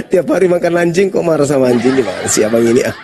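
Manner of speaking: amused taunting through laughter; laughing mockery. Real, not acted. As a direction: laugh it off and tease back. Laughing at the insult.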